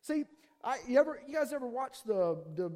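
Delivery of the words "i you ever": ordinary talk, nothing marked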